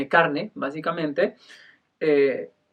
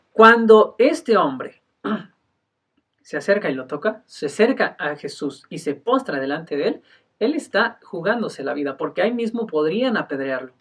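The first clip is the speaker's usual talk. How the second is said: normal talking voice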